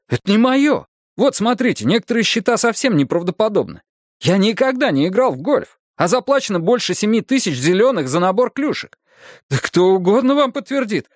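high-pitched, insistent protest; indignant exclamation. Acted. Husband disowns the purchases to detectives, exclaiming. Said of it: "воскликнул я".

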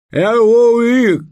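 loud, high garbled cry; mouth full. Acted. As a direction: blurt it through a mouthful. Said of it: "с набитым ртом". (громко, с набитым ртом)

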